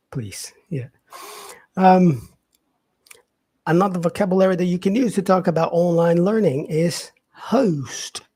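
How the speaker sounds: ordinary talk, nothing marked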